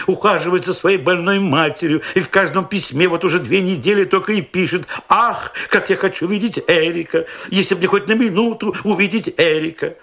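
high, eager proud-father voice; fussy young father. A narrator's reading. Young father boasts to narrator about his baby, high and eager.

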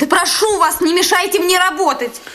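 high-pitched, heated request; agitated professional rebuke; indignant protest. Acted. Nurse protests to officer.

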